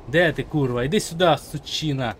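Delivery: loud angry challenge; angry taunting. Real, not acted. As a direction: call the enemy out angrily. Викликає ворога на бій. (злісний виклик)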